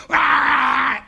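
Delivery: high, loud revving car sound; loud engine noise. Acted. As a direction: rev the imaginary Ferrari hard. Engine noise again, louder.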